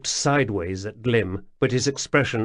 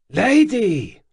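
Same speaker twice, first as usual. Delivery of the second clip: a sharp high cry of Lady, louder and much higher; exclaimed; 10 semitones higher, 6 dB louder than usual. A narrator's reading. The man caught licking the cable exclaims in alarm. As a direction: blurt it out, startled and urgent. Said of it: "he exclaimed".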